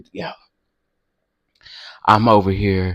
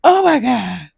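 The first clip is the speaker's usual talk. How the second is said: much higher and louder, breaking up with laughter; laughing shriek; 12 semitones higher, 7 dB louder, slower than usual. Real, not acted. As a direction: overcome by a laughing fit. Laughing fit, high and loud.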